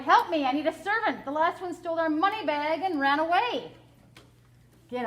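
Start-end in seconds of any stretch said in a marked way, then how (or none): 0.0-3.7 cried out. A narrator's reading.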